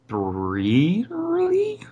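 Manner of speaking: BLEARILY stretched out slowly, rising high at the end; "blearily" drawn out into a high questioning rise; puzzled sounding-out. Real, not acted. Puzzled over an unfamiliar word.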